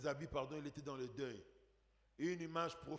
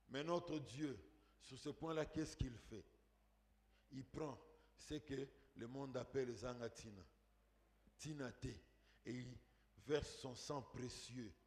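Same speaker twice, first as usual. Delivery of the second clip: low, soft, solemn preacher voice; hushed preaching; 5 semitones lower, 7 dB quieter than usual. Real, not acted. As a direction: drop right down and speak with quiet reverence. Preacher drops to hushed contrast.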